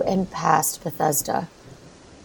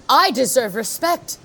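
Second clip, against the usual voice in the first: rising anger; 8 semitones higher than usual. Acted. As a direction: climb until she snaps at the end. Mother's outburst about being unheard.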